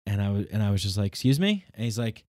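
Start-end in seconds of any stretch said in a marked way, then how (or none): 1.1-1.7 high, loud incredulous excuse me; startled, taken aback; high indignant surprise; surprised. Retold or imitated. Startled reply to rude walker.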